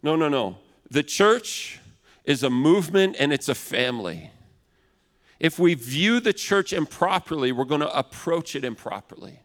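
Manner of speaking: normal talking voice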